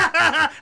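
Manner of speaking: high-pitched fit of laughter; helpless laugh. Real, not acted. Host still tickled by the Pirates joke, helpless laugh. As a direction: keep cracking up, unable to stop. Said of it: "without laughing".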